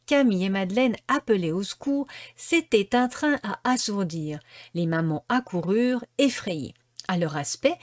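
usual speaking voice

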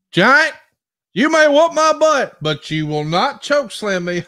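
high, loud trash-talk yell at the Giant; mock promo shout. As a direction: shout the taunt up high like a cocky heel. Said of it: "And you say something like".

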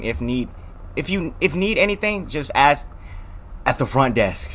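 lightly voiced, slow front desk man; slow, halting hotel worker, fairly soft. Front desk man reassuring a guest, slow role voice.

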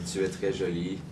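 "Tu es très jolie" in easy native French; "Tu es très jolie" in a casual native French accent; French phrase. Shown as a demonstration. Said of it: "Give me a French phrase".

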